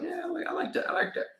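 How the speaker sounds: high, soft airy imitation of herself; mock fancy admiring. Vlogger retelling seeing a shirt, high mock coo. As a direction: coo over the shirt in a put-on voice. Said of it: "I was like".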